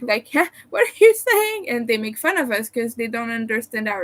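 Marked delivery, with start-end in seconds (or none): 0.3-1.7 high, loud baffled "huh?" from a Frenchman; high-pitched mock bewilderment; mocking French visitor. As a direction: play a puzzled visitor from France, teasing. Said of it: "entertained by the way we speak".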